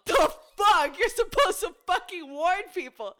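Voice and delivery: hammed-up angry dad; stepdad's outburst. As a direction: stepdad smelling the fart, loud mock outrage. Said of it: "her stepdad goes".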